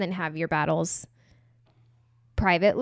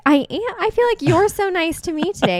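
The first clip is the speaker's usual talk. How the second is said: high, loud reply with "you're" punched; retold gushing, pitched way up and louder; 11 semitones higher, 9 dB louder than usual. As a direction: throw the compliment back, leaning hard on "you're". Gushing reply to partner's compliment, retold.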